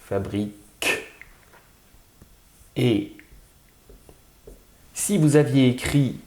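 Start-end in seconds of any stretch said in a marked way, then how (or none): 0.0-0.5 "Fabrique" with a detached, crisp k at the end; final consonant isolated. Shown as a demonstration. Teacher sounding out fabriquer, lone k ending. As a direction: separate the last k sound of "Fabrique".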